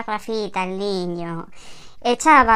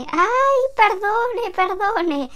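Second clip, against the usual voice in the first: chillido agudo de señora apurada; señora azorada disculpándose; 9 semitones higher, much slower than usual. Acted. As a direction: se disculpa muy aguda y atropellada. (señora que se ha equivocado de puerta, disculpas muy agudas)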